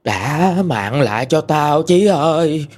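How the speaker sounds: slow, drawn-out haunting wail; moaning ghost. Acted. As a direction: moan it slowly, stretching words like a ghost. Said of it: "Còn rên rỉ là".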